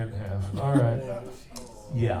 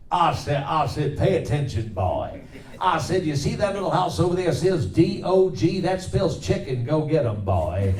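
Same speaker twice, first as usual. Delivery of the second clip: blustery rooster; 4 semitones higher than usual. Shown as a demonstration. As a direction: lecture the boy like a loudmouth farmyard rooster. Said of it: "I'll do a few voices real quick".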